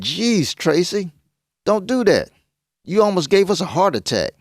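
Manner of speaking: high-pitched rattled reproach; startled scolding. Acted. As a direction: scold someone who just scared you. Scolding after being frightened.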